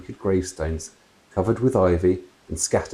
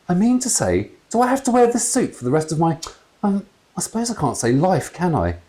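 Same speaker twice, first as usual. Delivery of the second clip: higher grumbling voice; lightly voiced grumbling dad; mumbling ghost father; 11 semitones higher than usual. A narrator's reading. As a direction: complain about the suit. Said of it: "mumbled her husband".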